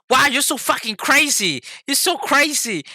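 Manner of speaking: high-pitched mock outcry; alarmed mom. Mom to her son as he keeps turning, alarmed. Mom panics during freeway turn.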